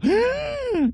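high sharp sharp intake of breath; shocked gasp. Retold or imitated. The bar crowd sees the severed head, gasping high.

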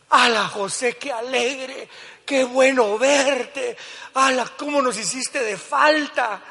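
high, slow, hammed-up delight; mock joyful brothers. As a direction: overplay the fake welcome. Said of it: "Usted cree que así dijeron".

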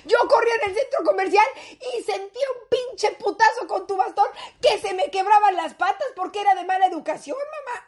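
high, whining grown daughter's complaint; indignant protest. Acted. Daughter reminding mom of the cane, indignant protest. Acted protest to her mother.

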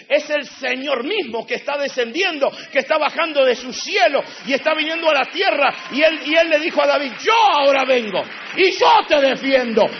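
fiery loud sermon climax; preaching at full force. Acted. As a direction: preach it to the rafters.

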